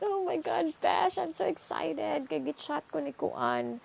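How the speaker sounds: high-pitched excited gushing; excited best friend. Acted. Student telling best friend about a crush's chat, excited gushing. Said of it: "You're excited, you're emotional, you're happy".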